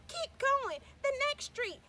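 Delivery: high and loud, slowing at the end; a girl urging them on toward home; loud eager little girl; excited child. Acted. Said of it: "She said".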